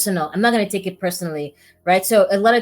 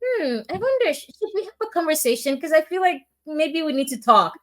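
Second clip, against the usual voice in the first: much higher, hesitant wondering voice; tentative colleague; 8 semitones higher than usual. Retold or imitated. The colleague suggesting they talk it out, tentative. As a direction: lightly voice a friend unsure whether to raise it. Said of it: "She's like".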